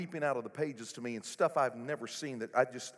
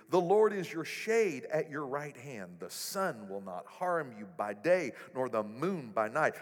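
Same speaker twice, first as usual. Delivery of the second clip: ordinary talk, nothing marked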